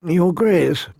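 lightly voiced smooth courtier; courtly address. A narrator's reading. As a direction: politely claim the king's attention. Littlefinger addressing the king at court.

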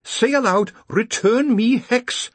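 crisp high-pitched answer; smartly. A narrator's reading. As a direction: recite the command like a keen subordinate. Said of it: "said Penny Smart smartly".

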